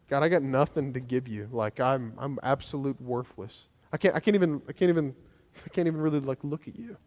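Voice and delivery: low, soft, humbled plea; broken confession. Acted. Tax collector confessing to God, low and broken. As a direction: confess like you have nothing to give.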